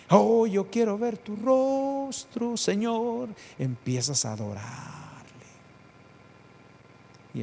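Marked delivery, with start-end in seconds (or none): 0.1-3.3 higher, slow longing plea; yearning prayer. Acted. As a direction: reach up to God with longing. Believer longing for God's face.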